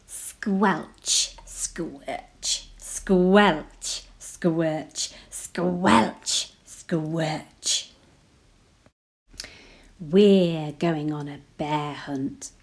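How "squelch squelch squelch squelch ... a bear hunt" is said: usual speaking voice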